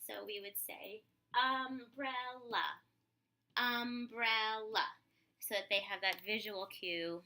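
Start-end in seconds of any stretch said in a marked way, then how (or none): 1.4-4.9 umbrella said slowly, one beat per syllable. Shown as a demonstration. Therapist shows parents syllable tapping, slow broken beats. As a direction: point to a star for each part of umbrella. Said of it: "use these stars as an example".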